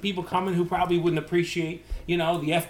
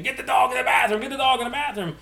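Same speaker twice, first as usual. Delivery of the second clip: raised pitch, mild mock yell; mock mom scream; 7 semitones higher than usual. Mom yelling to get the dog out, mock scream. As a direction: imitate a frantic mom, only lightly shouted. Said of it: "gotta get mom screaming".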